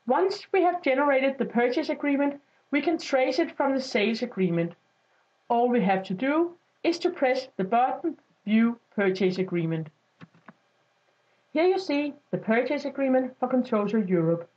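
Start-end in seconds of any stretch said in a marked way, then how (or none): none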